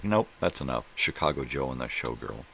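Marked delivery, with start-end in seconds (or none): 0.0-0.8 mock dismissal. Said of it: "it was like".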